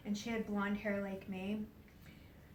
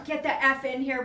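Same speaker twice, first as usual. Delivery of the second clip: laughing mock yell; 5 semitones higher, 11 dB louder than usual. Yelling for help, laughing.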